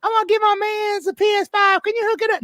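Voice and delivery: put-on girl he hasn't heard from calling with a high sugary hello; mock sweet-talking girl. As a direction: coo it like an old flame after a favor. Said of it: "the girl's gonna come on".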